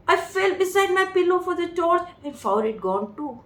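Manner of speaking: slow, measured reading of the novel; story reading. A narrator's reading. Teacher reads the story passage slowly to her students. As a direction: read the passage aloud slowly for the class.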